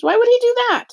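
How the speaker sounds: very high, loud indignant question; outraged. A narrator's reading. Girl demands to know why he lied, outraged. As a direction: protest in high-pitched outrage. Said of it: "Lena said, outraged".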